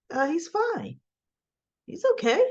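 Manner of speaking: high-pitched mock woman's reply; high, would-be casual reassurance; imitated evasive woman. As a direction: lift the voice high to brush off the question. Woman's evasive answer to jealous man.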